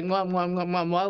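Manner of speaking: little smacking kiss sounds; kiss noises. Retold or imitated. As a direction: blow a string of sweet little kisses. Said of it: "be like".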